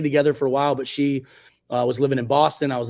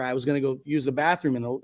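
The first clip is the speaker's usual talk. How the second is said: ordinary talk, nothing marked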